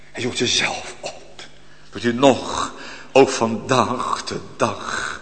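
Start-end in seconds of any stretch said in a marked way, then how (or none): 0.1-1.4 hushed whisper. Acted. Repeated softer, unvoiced.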